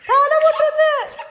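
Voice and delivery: high, slow, loud warning cry; high alarmed call. Real, not acted. Warning child about head bump.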